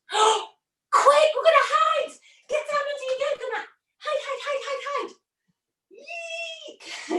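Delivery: high, breathless hurry to hide; urgent excitement. Acted. Urging children to hide.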